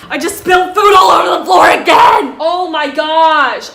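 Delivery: loud, exasperated outburst at spilling food; shouting, fed up with your own clumsiness; frustrated shout. Real, not acted. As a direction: yell in real annoyance at yourself. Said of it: "I'm sorry for being angry".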